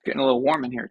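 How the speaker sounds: ordinary talk, nothing marked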